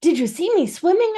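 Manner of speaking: high, loud eager question; excited squeak. A narrator's reading. Roo asking everyone excitedly about his swim. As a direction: burst with pride as a tiny joey. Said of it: "asked Roo excitedly".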